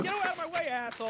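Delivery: high, loud street-crowd shout; mock angry yell. New Yorker bumped on the sidewalk, mock angry yell. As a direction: yell it like an irate passerby.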